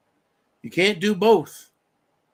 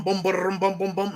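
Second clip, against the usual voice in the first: vocal drum roll, bum-bum-bum; imitated drumbeat; 2 semitones higher than usual. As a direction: drum it out rhythmically with the voice. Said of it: "beat on a drum".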